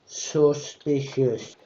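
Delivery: very slow suspicious; drawn out. Pretend. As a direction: stretch every syllable. Drawn-out gag word.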